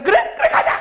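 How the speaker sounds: very high, thrilled mock reply; eager agreement. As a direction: jump on the plan, acting delighted. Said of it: "그 친구가 간다고". (신나서 높게 맞장구치는 흉내)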